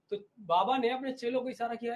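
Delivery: ordinary talk, nothing marked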